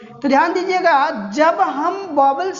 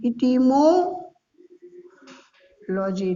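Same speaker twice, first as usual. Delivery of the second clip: etymology stretched very slowly, syllable by syllable; drawn-out etymology; 2 semitones lower, 3 dB quieter, much slower than usual. Shown as a demonstration. Teacher drawing out etymology for his students, very slow. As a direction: spell etymology out for the class at a crawl. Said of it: "teacher repeating the answer term".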